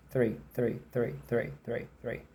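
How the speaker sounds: quick, soft "three, three" repeated; fast "three". Shown as a demonstration. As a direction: rattle off "three" at natural speed. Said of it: "Mas se eu falo rápido".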